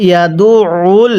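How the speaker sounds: ইয়াদুউল with ain pressed in the throat. Shown as a demonstration. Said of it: "তাহলে কিভাবে পড়তে হবে?". (ইয়াদুউল গলায় চাপ দিয়ে)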